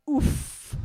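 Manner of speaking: a soft voiceless oof, wincing at the article; quiet wince. Retold or imitated. Fact checker reacting to the article, quiet voiceless oof. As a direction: breathe out a quiet pained oof. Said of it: "She goes".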